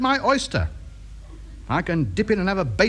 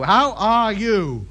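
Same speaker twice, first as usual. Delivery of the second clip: "How are you" drawn out word by word; slow, over-careful "How are you"; slowed for a foreigner; much slower than usual. Acted. Repeats slowly for uncomprehending foreign listener.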